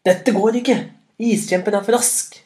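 high, loud cry of alarm; frustrated shout. A narrator's reading. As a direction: call out that you can't manage it. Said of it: "ropte Nila".

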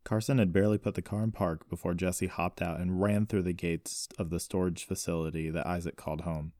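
usual speaking voice

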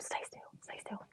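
urgent whisper. Real, not acted. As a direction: whisper the command. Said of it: "whispering during the prank".

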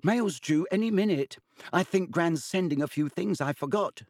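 put-on high, chirpy cheerful boy. A narrator's reading. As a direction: greet your friends brightly, pitched well up. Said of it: "greeted them cheerfully".

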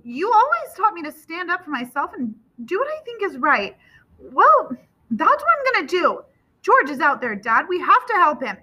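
put-on high-pitched, insistent young son; urgent, heated plea; impassioned defiance. A narrator's reading. Son stands up to father.

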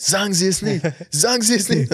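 pleading. Retold or imitated. Quoting meme.

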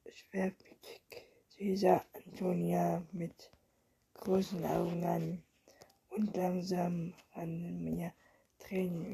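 hushed storyteller's whisper throughout; whispered story reading. A narrator's reading. Reading a bedtime story aloud, whispered. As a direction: read the tale under your breath.

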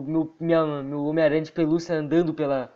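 ordinary talk, nothing marked

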